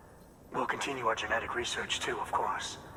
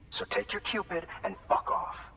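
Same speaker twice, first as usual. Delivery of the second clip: angry dismissal; 9 semitones higher than usual. Acted. Junk dealer dismissing porter.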